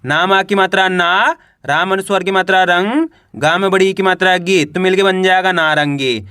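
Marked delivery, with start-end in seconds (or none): 0.0-4.6 teacher sounding out ना, रंग, गी one by one; syllable-by-syllable spelling. Shown as a demonstration. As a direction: stretch ना, रंग, गी with rising ends. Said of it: "कैसे पढ़ेंगे एक बार फिर से देखिए".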